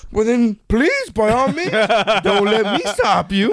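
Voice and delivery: high, slow, sarcastic politeness; sarcastic officer. Retold or imitated. Sergeant sarcastically dismissing a soldier, slow and high. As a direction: stretch out the mock courtesy. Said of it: "his tone was totally sarcastic".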